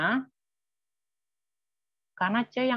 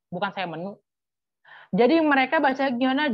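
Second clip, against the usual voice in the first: ordinary delivery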